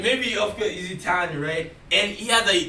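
ordinary delivery

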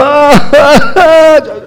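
high mock wail of a weeping woman; imitated weeping. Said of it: "So she's weeping. She's like".

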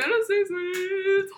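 high, slow, drawn-out teasing voice; mock giggly girl. Mocking the girls' fantasy suite jokes, high and giggly. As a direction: squeal it like a giggling contestant.

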